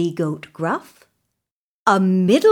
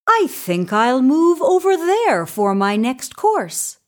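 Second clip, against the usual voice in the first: lightly voiced goat, somewhat high; a hint of creature voice; middle-sized goat; 6 semitones higher than usual. A narrator's reading. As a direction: raise the pitch moderately for a medium goat. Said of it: "the middle-sized Billy Goat Gruff".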